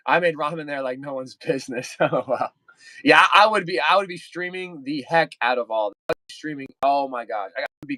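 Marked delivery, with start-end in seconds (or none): none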